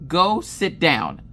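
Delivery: slow, emphatic mock command; hammed-up exasperated repeat; retold firm order. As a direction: draw out each word sternly. Husband repeats order more firmly.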